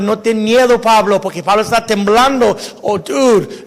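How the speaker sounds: usual speaking voice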